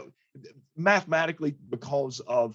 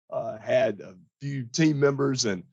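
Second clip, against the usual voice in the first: usual speaking voice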